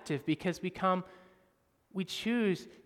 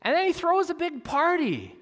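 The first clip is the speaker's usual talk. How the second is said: excited; 13 semitones higher, 7 dB louder than usual. Real, not acted. Excited turn in sermon.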